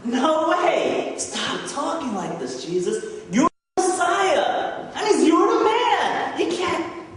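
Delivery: high, loud mock protest; indignant rebuke. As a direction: act out an agitated disciple telling off the teacher. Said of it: "He rebukes Jesus".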